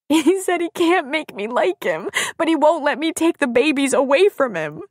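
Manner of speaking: high, wailing tearful voice; weeping. A narrator's reading. Woman weeping on friend's shoulder, weeping. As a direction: sob out the complaint. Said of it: "wept on her shoulder".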